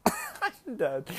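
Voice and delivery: normal talking voice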